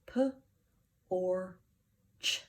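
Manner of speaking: P, or, ch tapped one sound at a time. Shown as a demonstration. Teacher sounding out the word for the class, sound by sound. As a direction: tap "P, or, ch" apart.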